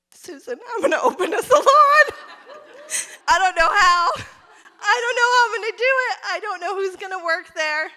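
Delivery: high, loud, laughing through tears; half-crying, half-laughing declaration; tearful laughing resolve. Retold or imitated. Telling Susan she will open the salon, laughing through tears. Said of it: "with tears in my eyes".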